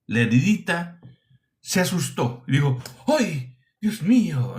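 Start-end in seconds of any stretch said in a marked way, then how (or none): 3.1-4.6 imitating a startled cry, pitch jumping up; light imitation of a frightened exclamation; mock startled gasp. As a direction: mimic someone scared by a wound, a touch hammed. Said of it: "esa expresión de as-, de susto, de asombro".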